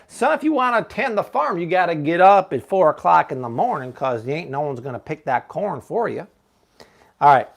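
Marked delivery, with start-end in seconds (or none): 0.1-6.3 folksy Southern grandpappy advice; Southern accent. Shown as a demonstration. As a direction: put on a playful Southern farmer's twang. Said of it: "I think I do a pretty good Southern accent".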